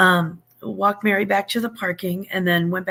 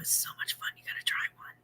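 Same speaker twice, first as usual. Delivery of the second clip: whispering; 14 dB quieter, much faster than usual. Real, not acted. Aside to Liv in chat.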